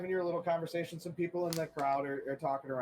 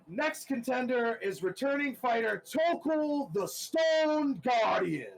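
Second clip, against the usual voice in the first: booming announcer; 8 semitones higher than usual. Acted. Overhead announcer shouting the returning fighter. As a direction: boom the name overhead. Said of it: "the voice comes overhead again".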